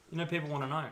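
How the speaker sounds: ordinary talk, nothing marked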